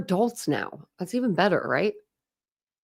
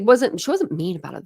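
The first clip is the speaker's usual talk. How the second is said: ordinary talk, nothing marked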